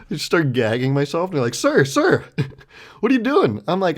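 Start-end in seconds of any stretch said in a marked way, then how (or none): 1.5-3.6 high, louder mock alarm; urgently interrupt, pitched way up; imitating a startled bystander calling out; alarmed onlooker. Acting out alarmed onlookers interrupting him.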